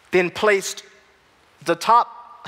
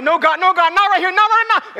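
rising higher and louder, pleading with God; protest, caught by emotion in the store aisle; overwhelmed plea; 11 semitones higher, 6 dB louder than usual. Retold or imitated. In a Walmart aisle, pleading to God, rising high and loud. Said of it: "and I'm like".

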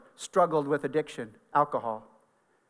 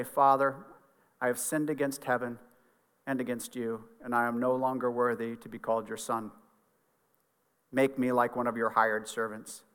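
low, soft, subdued reading of a penitent son; humble confession; 5 semitones lower, 5 dB quieter than usual. A narrator's reading. Son rehearsing his apology to his father, low and humble. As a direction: confess quietly, as one unworthy.

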